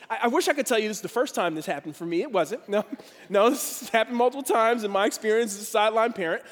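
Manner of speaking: ordinary talk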